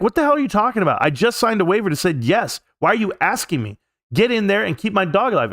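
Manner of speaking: high, heated outburst at the staff; furious demand. Retold or imitated. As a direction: snap back in outrage. Angry reply to vet staff.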